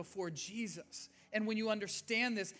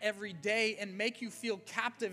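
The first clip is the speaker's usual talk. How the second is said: rising full-force preaching; 4 semitones higher, 6 dB louder than usual. Acted. Pastor preaching to his church, building to an excited cry.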